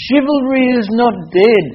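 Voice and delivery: high-pitched imitation of a woman declaring praise; admiring Russian woman. Russian teacher praising the man who helped, high admiring voice. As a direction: proclaim it high, drawn over big pitch swoops.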